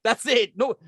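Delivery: ordinary talk, nothing marked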